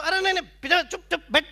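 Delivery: high, loud, bossy order; imitated guard's shout. Jail guards shove the poet back among prisoners, imitated shouting. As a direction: shut him up like a jail guard.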